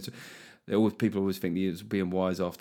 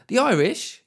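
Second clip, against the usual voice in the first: ordinary talk, nothing marked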